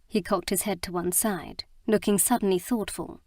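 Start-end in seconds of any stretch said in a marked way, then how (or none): none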